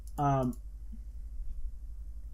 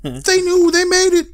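high, loud conspiracy shouting; mock Twitter outrage; 10 dB louder than usual. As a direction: imitate a hysterical online crowd. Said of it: "people on Twitter being like".

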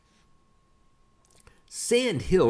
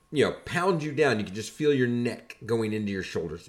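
normal talking voice

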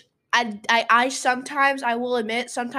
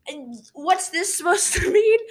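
higher, louder, drawn-out demand for an explanation; retold incredulous question; 7 semitones higher, 5 dB louder than usual. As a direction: imitate a teacher demanding an explanation, pitched up and slowed. Retold teacher questioning a drawing.

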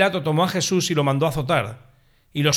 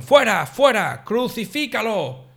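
crowd shouting; 6 semitones higher, slower than usual. A narrator's reading. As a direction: roar it as the crowd rejecting their king. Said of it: "Ellos gritaron". (grito alto y lento de la multitud)

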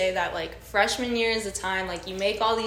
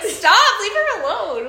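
loud protective yell, pitched up; shouting; 11 semitones higher, 8 dB louder, slower than usual. Retold or imitated. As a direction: call out sharply to stop them. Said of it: "I was like".

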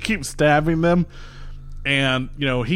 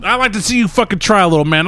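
higher, louder taunt; sneering threat at the little fighter; taunting challenge; 8 semitones higher, 5 dB louder than usual. Acted. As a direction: dare him to try it. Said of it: "he's like".

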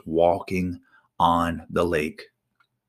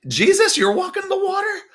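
high-pitched, giddy excitement; hammed-up thrilled shouts easing into eager chatter; eager Peter; 8 semitones higher than usual. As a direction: play an over-eager disciple begging to join in. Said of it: "And Peter, probably like me".

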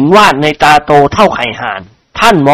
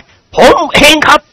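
slow, high admission after a gulp; nervous confession; 10 semitones higher, slower than usual. Acted. Chao Khun nervously owns up to Khunying. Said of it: "กลืนน้ำลายเอื้อก".

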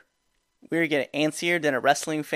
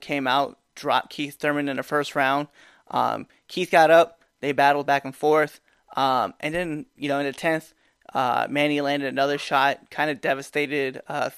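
normal talking voice

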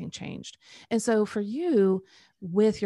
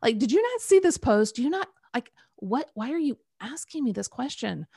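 sassy retort climbing higher and louder; 5 semitones higher, 3 dB louder than usual. Retold or imitated. Retelling a sassy reply to God, rising incredulous. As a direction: snap back at God, incredulous. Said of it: "I was very sassy".

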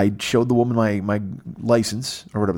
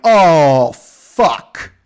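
loud, higher oh then groaned fuck; sudden dismay; 6 semitones higher, 9 dB louder than usual. Retold or imitated. At the airport belt he realizes the mic is missing, loud sudden dismay. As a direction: realize the mistake mid-sentence.

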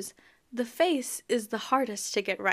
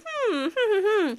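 mock worried humming; 11 semitones higher than usual. She imitates Grover's nervous humming in a high voice.

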